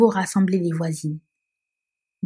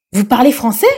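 louder, stunned question; astonished question; 3 semitones higher, 6 dB louder than usual. Acted. Police chief stunned that the girl speaks French, astonished. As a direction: blurt it out, caught off guard. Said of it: "éberlué".

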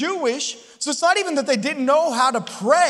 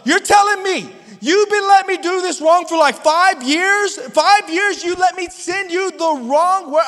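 louder, higher indignant accusation; angry wife; 7 semitones higher, 6 dB louder than usual. Retold or imitated. Wife scolding husband over years of misspelling. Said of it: "angry look on her face".

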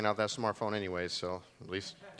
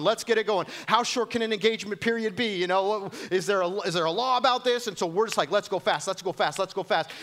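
much higher, louder, breathless hurry; mock frantic rush; 9 semitones higher, 7 dB louder than usual. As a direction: play the impatient couple racing to the altar. Mocking couples rushing into marriage.

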